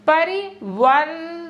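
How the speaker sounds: परिवर्तन drawn out slowly syllable by syllable; slow careful pronunciation. Shown as a demonstration. Teacher models परिवर्तन slowly for class. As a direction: sound out परिवर्तन slowly for learners. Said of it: "इसमें देखो".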